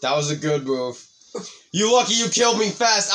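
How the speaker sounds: ordinary talk, nothing marked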